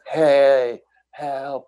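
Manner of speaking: high, drawn-out yell, imitating a scream; screaming for help. Buddy yelling to a distant mountain biker for help, retold scream. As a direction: call out high and long, as if to someone far off. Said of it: "he's screaming".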